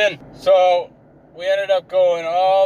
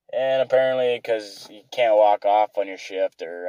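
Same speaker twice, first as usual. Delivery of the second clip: usual speaking voice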